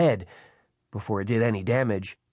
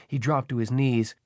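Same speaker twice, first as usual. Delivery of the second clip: plain reading voice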